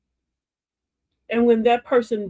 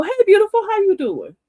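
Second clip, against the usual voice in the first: high, loud cheery hello to a stranger; bright greeting; 12 semitones higher, 7 dB louder than usual. Retold or imitated. Greeting a sad woman in the store, high and loud. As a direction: call out sweetly to cheer her up.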